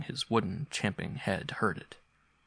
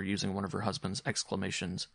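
usual speaking voice

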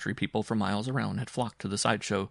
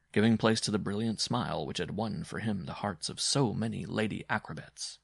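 plain reading voice

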